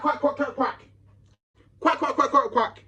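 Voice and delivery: high, rapid-fire mock quacking. Taunting a rival for talking shit, mocking quack.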